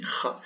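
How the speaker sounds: "ra" with a back-of-throat r; German throaty r. Shown as a demonstration. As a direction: scrape "ra" at the back. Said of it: "ou allemand aussi".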